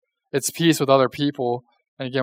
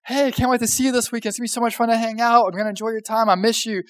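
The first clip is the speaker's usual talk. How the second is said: gushing text; 6 semitones higher, faster than usual. Retold or imitated. Mock enthusiastic text read out.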